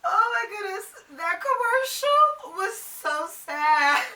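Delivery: high, loud, drawn-out mock weeping; hammed-up teary whine, very slow; mock tearful wail. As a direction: wail it dramatically, as if overcome. Cold open, acts crying at commercial.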